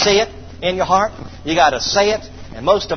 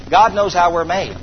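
normal talking voice

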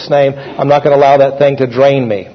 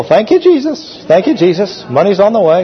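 exclaimed thanks; 3 semitones higher than usual. Retold or imitated. Acted prayer over a bill.

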